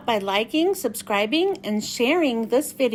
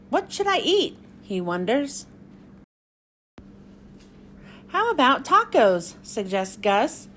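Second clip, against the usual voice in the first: bright, lively picture-book read-aloud; reading to children; 2 semitones lower than usual. Acted. Miss Michelle reading Pete the Cat to young viewers, lively story-time voice.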